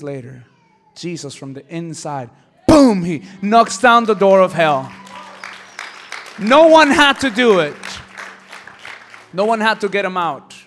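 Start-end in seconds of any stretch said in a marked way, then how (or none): 2.7-10.5 full-force preaching of the resurrection; preaching shout. Real, not acted. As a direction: let the victory ring out. Preaching climax.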